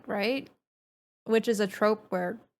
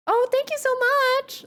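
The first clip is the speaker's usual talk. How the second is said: much higher, slow and sugary-friendly; customer service voice; 5 dB louder, slower than usual. Shown as a demonstration. Showing how she would voice a friendly thanks, high customer service voice. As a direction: chirp it up high to sound harmless. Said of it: "I'd be like".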